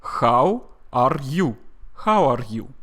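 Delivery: loud Russian accent with kh and rolled R; deliberate, Russian-accented demonstration. Shown as a demonstration. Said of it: "How would a Russian native speaker say".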